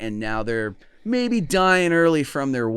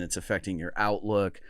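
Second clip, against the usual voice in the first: usual speaking voice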